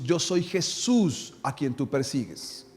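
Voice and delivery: low, slow, solemn voice of Jesus. Retold or imitated. Preacher voicing Jesus answering Paul on the road, low and slow. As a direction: speak slowly and softly, like a divine voice answering.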